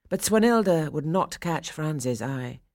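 plain reading voice

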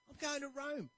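high cheerful announcing; happy traveler. Retold or imitated. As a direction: beam about the trip. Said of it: "he's really happy".

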